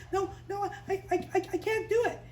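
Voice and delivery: high, stammering refusal; acted flustered pleading; nervous Moses. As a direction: stutter your way out of it. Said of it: "when I get nervous".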